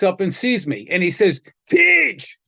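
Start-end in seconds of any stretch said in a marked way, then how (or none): 1.7-2.4 high, drawn-out call of a nickname; retold hail across the house; calling out greeting. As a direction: imitate a friend calling out in delight. Bucky calling out on seeing him.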